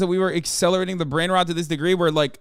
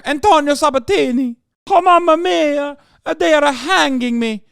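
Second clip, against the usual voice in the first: hammed-up fake Italian accent, much higher and louder; 8 semitones higher, 5 dB louder than usual. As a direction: play an over-the-top Italian caricature. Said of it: "the fake Italian accent".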